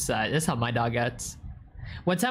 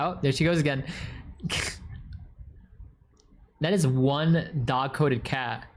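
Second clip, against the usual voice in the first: normal talking voice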